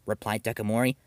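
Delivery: ordinary delivery, nothing marked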